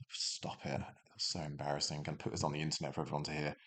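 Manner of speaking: whispered, then low and fast; embarrassed mumble. Real, not acted. Teacher embarrassed by own voice, mumbling fast. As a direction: mutter it, cringing at yourself. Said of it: "embarrassed at own voice".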